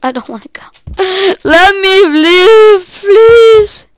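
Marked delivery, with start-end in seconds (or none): 1.4-3.9 high, loud, slow begging cry; desperate pleading. Real, not acted. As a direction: plead for your life. Begging in game panic.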